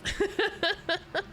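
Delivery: high short giggle. Real, not acted. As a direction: giggle at the big if. Laughs after big if.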